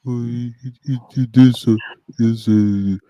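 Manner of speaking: imitated incoherent mumbling; mock muttering. Cornered hater asked why they hate, mock muttering. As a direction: mimic someone stammering into wordless grumbles. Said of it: "They'll start muttering".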